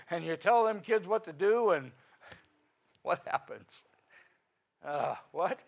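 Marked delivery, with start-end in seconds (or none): none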